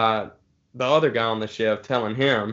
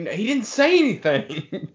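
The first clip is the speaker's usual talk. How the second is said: higher, slow, laughing through the words; laughing mock protest; 10 semitones higher than usual. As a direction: imitate the others' protest while cracking up. Coworkers insisting, teller laughing.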